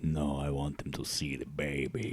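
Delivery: very low, soft, laughing villain voice; mock deep Bardem. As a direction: play the creepy husband low and soft. Quoting Javier Bardem.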